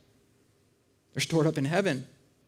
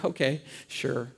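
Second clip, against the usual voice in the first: soft, low, slow humoring agreement; patronizing; 7 semitones lower, 7 dB quieter, slower than usual. Acted. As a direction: condescendingly play along, drawn out and quiet. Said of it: "patronizing him, but it feels that way".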